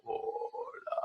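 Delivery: "Hola" as a whispered, croaked very low growl; extremely low voice demo. Shown as a demonstration. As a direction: try to drop to the lowest note. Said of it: "Sonaría algo así".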